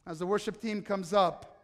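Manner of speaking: ordinary delivery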